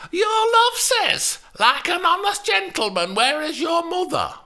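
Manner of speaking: high, loud mocking Lancashire imitation, shouted at the end; mocking Lancashire accent. Juliet mocks the nurse in her Lancashire accent. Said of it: "imitated Lynn's Lancashire accent".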